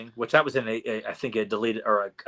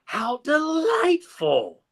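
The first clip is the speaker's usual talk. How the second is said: high, slow, sugary villain purr; hammed-up witch relishing the moment; sinister witch; much slower than usual. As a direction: savor it like a gloating witch. Villain witch voiced in retelling.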